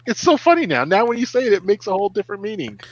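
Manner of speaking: high, delighted kid impression; imitating amused daughters. Daughters telling dad the joke now makes sense, imitated delight. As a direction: pitch it up as the girls. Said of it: "They're like".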